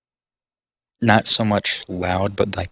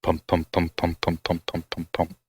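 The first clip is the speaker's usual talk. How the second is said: steady, evenly spaced beats, fading softer; rhythmic thudding; 2 semitones lower, 6 dB quieter than usual. Shown as a demonstration. Game master imitates distant thudding sound for players, rhythmic. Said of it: "that's very rhythmic".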